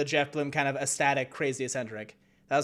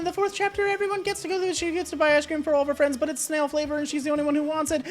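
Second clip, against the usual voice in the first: much higher, fast nervous nerdy scientist; Alphys voice; 10 semitones higher, faster than usual. Acted. Shy scientist gushing about her favorite anime to a quiz host, high and fast. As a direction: gush like a shy fan who knows the answer.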